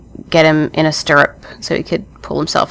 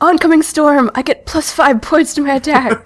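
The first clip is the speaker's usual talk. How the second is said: mock epic; 9 semitones higher than usual.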